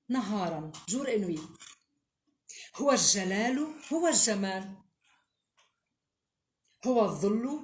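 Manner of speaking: solemn Arabic recital with long pauses; poem recitation. Acted. (إلقاء شعري مهيب)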